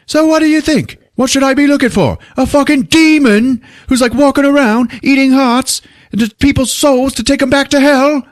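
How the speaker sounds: high, loud mock-incredulous rant; exasperated chief. Police chief to his detective, high loud exasperated rant. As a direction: play a sarcastic boss going off. Irate chief challenges Stone in retelling.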